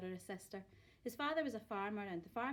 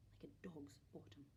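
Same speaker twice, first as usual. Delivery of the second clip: whispered aside, very soft; a rude secret whispered to the listener; cheeky whisper; 6 semitones lower, 13 dB quieter than usual. A narrator's reading. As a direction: drop to a naughty whisper.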